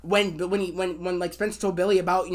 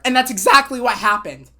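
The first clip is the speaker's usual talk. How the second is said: normal talking voice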